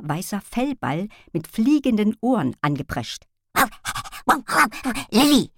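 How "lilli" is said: loud excited dog call; barking call. A narrator's reading. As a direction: yelps her name, loud. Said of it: "bellte der Hund".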